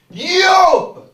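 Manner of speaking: a huge high savage yell; barbaric yawp. Shown as a demonstration. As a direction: roar it like a barbarian. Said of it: "c'est un petit miaou".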